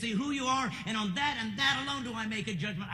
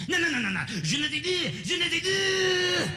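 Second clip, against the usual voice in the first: higher, louder shouting French correction; freaking out; 10 semitones higher, 6 dB louder than usual. Retold or imitated. Frenchman shouting at an American's bad French, furious. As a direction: yell the correction like an outraged Frenchman. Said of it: "They freak".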